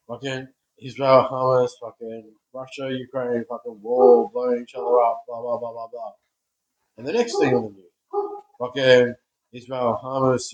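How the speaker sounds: loud, exasperated rattling off of the news; yelling in frustration at the nightly news; angry rant. Real, not acted. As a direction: shout the list out in disgust. Ranting about the news.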